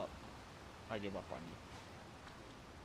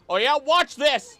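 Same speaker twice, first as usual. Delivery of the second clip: higher, louder boast; cocky challenge; 12 semitones higher, 9 dB louder, slower than usual. Real, not acted. Streamer taunting a Pokemon before throwing, cocky loud challenge.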